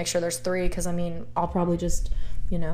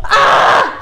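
short, high, loud scream; real piercing yelp; excited scream; 14 semitones higher, 10 dB louder than usual. Real, not acted. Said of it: "I just screamed at all of you".

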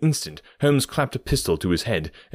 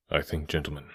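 low, hushed composure; quiet; 5 semitones lower, 8 dB quieter than usual. A narrator's reading. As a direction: drop the voice, softly in control. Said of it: "said Holmes quietly".

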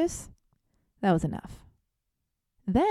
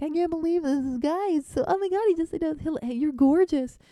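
high, giddy squealing retelling; gushing to friends; 8 semitones higher, 3 dB louder than usual. Retold or imitated. Texting friends about the hot guy, giddy high squeal. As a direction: squeal it like a starstruck fan. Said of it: "and I was like".

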